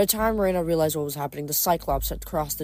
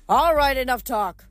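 loud, higher, itching to fight; gruff shout; 8 semitones higher, 6 dB louder than usual. A narrator's reading. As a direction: bark it like a coach. Coach Hedge eager to fight.

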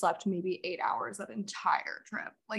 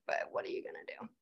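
whisper; 12 dB quieter, faster than usual. Real, not acted. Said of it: "resigned aside".

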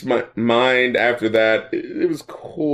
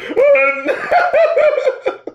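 high burst of laughter. Real, not acted. Laughing at the "Previously on" intro.

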